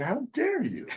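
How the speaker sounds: high-pitched mock outrage; mock indignation. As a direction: act scandalised, pitching it way up. Acted offence at misunderstood word.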